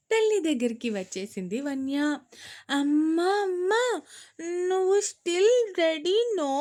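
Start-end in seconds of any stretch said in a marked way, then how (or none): 2.7-6.5 put-on high, rising, slow child's calls to mom; a little girl sweetly hurrying her mother. A narrator's reading. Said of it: "అడిగింది పాప ముద్దుగా". (ముద్దుగా అమ్మను పిలిచే పాప)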